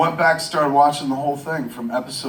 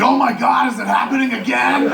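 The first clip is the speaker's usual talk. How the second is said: high, panicked mock cry; alarmed; 11 semitones higher, 4 dB louder than usual. Answering friend's call fearing another September eleventh, alarmed. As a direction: act out sudden dread, pitched way up.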